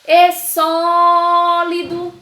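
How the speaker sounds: high, loud call with a long "só" in "sólido"; drawn-out stressed syllable. Shown as a demonstration. Teacher calling the word for pupils, "só" in "sólido" stretched long. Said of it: "Vou chamar de novo pra vocês identificarem".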